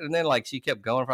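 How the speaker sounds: ordinary delivery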